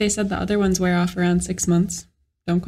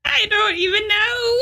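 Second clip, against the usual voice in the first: laughing; 11 semitones higher than usual. Real, not acted. Giggling through the answer.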